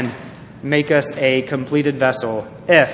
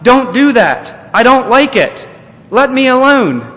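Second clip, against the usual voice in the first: yelled protest; 9 semitones higher, 7 dB louder than usual. Acted. Teacup yelling at the potter to stop, loud protest. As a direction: yell it out, high and forceful. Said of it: "And I yelled out".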